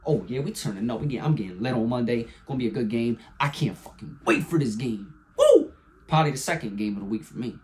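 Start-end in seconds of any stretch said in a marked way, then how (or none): 5.4-5.7 excited whoop. Real, not acted. Hyped about Monday night game.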